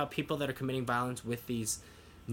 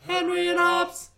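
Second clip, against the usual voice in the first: very high, louder, cartoon squeal of the show name; squeaky silly voice; 9 dB louder than usual. Silly sign-off, squealing the show name very high.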